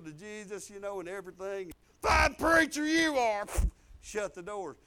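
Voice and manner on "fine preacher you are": mock wifely yell, stretched out; loud scornful jab. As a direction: holler it high and slow as the scolding wife. Wife's retort acted out again.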